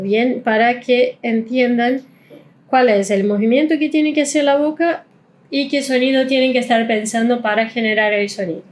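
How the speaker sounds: usual speaking voice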